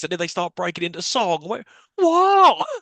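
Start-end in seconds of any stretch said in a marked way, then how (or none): 1.5-2.7 high, loud startled disbelief; sudden squeaky double take; wait what. Real, not acted. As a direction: jump up in pitch, truly thrown. Stunned by the film's song.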